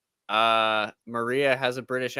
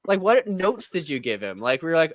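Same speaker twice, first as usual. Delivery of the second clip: ordinary delivery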